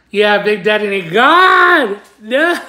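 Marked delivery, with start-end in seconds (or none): 1.1-2.6 loud, high "God"; exasperated cry. Real, not acted. Reacting to the teasing nickname, loud exasperated cry. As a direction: burst out, mock despair.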